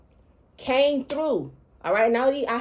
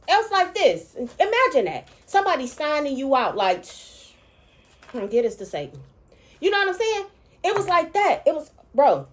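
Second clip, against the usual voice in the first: high, loud, overwhelmed outburst; words bursting out, barely holding it together; losing composure; 8 semitones higher, 4 dB louder than usual. Real, not acted. Overwhelmed recalling the dream to viewers, high and loud. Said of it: "I can't hold my composure here".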